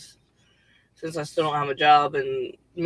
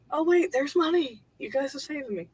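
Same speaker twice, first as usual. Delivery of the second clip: very high, softer voice of happy discovery; relieved surprise, reenacted; 10 semitones higher, 4 dB quieter than usual. Thanking donors for saving her, reenacted relieved surprise. Retold relief at finding donations.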